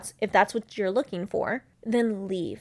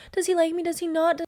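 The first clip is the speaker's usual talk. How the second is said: higher, lightly fretting "Does he like me?"; mock anxious woman; 7 semitones higher than usual. Host mocking women who obsess over men, anxious mock voice. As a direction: play the insecure dater, a touch hammed-up. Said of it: "you being like".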